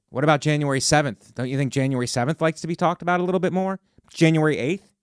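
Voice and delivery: ordinary delivery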